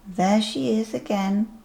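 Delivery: plain reading voice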